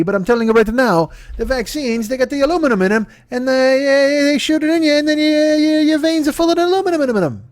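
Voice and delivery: a clueless attempt at a Brazilian accent; mock Brazilian accent. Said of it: "I don't know what a Brazilian accent would be".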